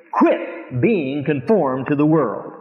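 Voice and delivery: Quit being conformed to the world, said slowly and firmly; slow strong command. Shown as a demonstration. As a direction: land each word as an order. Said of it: "It is a very strong verb".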